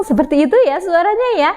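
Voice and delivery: plain reading voice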